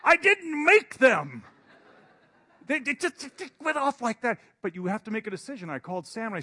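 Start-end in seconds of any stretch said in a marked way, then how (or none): 0.0-4.4 exasperated outburst. Retold or imitated. Insisting to viewers the computer made the changes, laughing exasperation. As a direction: protest in disbelief.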